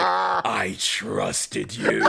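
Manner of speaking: drawn-out, menacing, low-pitched line; slow dramatic menace. Retold or imitated. Podcast host acting Cassie confronting Adam, slow menacing voice. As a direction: play the betrayed dark witch, slow and low. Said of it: "she's like".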